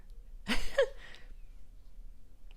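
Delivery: breathy high giggle. Real, not acted. Laughs at own ASMR love.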